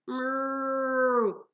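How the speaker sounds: long, high lowing moo; cow moo. A narrator's reading. The cow serpent calling to Percy at the dock, a drawn-out moo.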